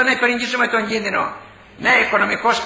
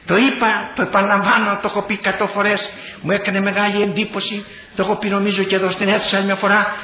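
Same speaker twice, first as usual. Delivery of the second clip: normal talking voice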